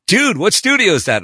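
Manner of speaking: high, loud mock astonishment; amazed reaction. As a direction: imitate a caller blown away by the sound. Acted amazed reaction to the recording.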